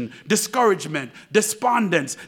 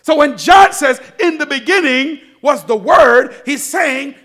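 shouting preacher, proclaiming to the church; preaching at full force; 5 semitones higher, 8 dB louder than usual. Acted. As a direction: hammer the point home.

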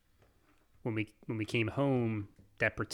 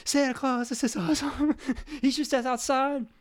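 put-on much higher, thrilled child's squeal; excited kid; 4 dB louder than usual. Boy spotting Santa at the window, much higher excited squeal. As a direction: gush like a kid who just saw Santa. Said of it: "Of course you're excited".

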